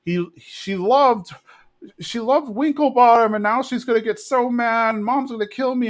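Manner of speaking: high-pitched retold panic; freaking-out Bobby. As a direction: imitate a frantic child in trouble. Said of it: "Bobby's freaking out".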